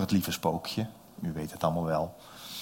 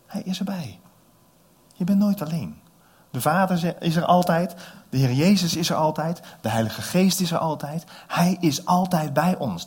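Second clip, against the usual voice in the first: fervent preaching; 6 semitones higher, 4 dB louder than usual. Real, not acted. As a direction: preach it with conviction. Preacher's climax on presence. (vurig preken)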